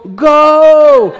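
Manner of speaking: crowd cheer yell. Retold or imitated. What the runner hears from the stands, loud cheering yell. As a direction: holler like fans urging him on. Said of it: "él está oyendo".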